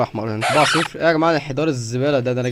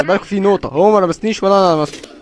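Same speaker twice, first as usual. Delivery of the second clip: usual speaking voice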